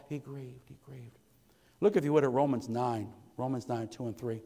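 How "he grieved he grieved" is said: much softer, barely above a breath; hushed grief. Acted. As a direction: repeat it softly, letting it sink in. Softly repeating grief.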